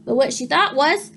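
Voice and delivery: ordinary delivery, nothing marked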